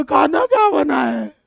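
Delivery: slow, big-mouthed shouted demand; a teacher mimicking a big-mouthed hippo asking about food; mock hippo voice. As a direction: bellow it like a hungry hippo with a huge mouth. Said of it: "ऐसे बड़का मुंह".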